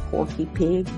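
ordinary talk, nothing marked

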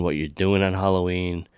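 normal talking voice

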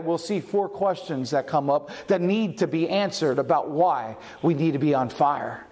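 ordinary talk

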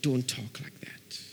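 slow, hushed whisper of a stern God; whispered rebuke. Acted. God firmly hushes a doubting Ezekiel in a whisper. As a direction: breathe the rebuke, no voice.

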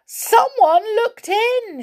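high, slow, startled cry; frightened. Acted. Goldilocks frightened, telling everyone someone looked in. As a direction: gasp it out in fright at the window. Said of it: "she said".

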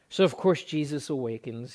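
usual speaking voice

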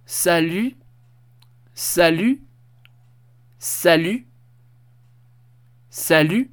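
"salut" con la u francesa /y/, pausado; francés. Shown as a demonstration. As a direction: decir "salut" claro, con pausas para que el alumno repita. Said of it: "repitas conmigo". (repetir "salut" con el alumno, como modelo)

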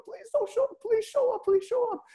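very high falsetto pleading; hammed-up, desperate falsetto prayer; mock begging. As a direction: repeat the plea in an exaggerated high voice. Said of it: "literally begging the Lord".

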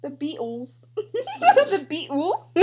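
mock British accent, giggling; slow, laughing British accent. Rendition of British people, mock British accent. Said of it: "our renditions of British people".

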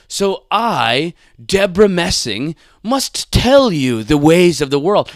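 loud, pompous celebrity lecturing; Deborah Messing. Acted. Celebrity tells construction worker how it is, pompous. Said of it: "basically you're like".

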